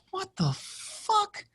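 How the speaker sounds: drawn-out high shocked what the fuck; slow incredulous gasp of a question. Retold or imitated. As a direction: stretch it out in disbelief. Retelling his shocked reaction.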